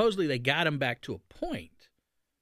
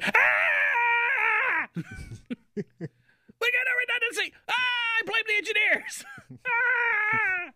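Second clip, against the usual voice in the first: mock panic; slower than usual. Panicked budget holder blaming the engineers, hammed-up high shriek. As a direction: ham up a frantic wail and blame someone.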